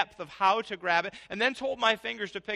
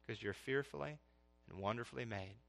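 much softer, lower, reverent; hushed reverence; 7 semitones lower, 13 dB quieter than usual. Real, not acted. Preacher hushes as he quotes the psalm, softly reverent. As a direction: let the verse fall to a hush.

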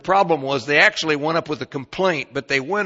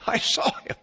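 mock annoyed protest; 11 semitones higher, 4 dB louder than usual. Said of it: "And I say".

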